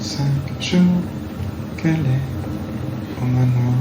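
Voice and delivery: reversed audio, slow and warped; like a recording run backwards; played backwards. Shown as a demonstration. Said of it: "il suffit que, hop, je l'inverse".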